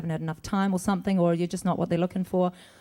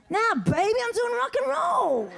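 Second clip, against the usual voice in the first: mock rocker; 13 semitones higher than usual. Asked about recording a lullaby, mock rocker brag. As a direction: belt it out high, like a rocker. Jokes she only records rock.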